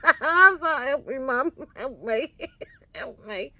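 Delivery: loud, high laughing plea. Real, not acted. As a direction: beg for help through laughter.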